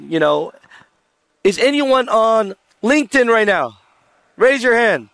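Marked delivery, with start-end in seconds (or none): 1.4-5.1 raised-pitch crowd poll; calling to audience. Real, not acted. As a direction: call out to the whole room.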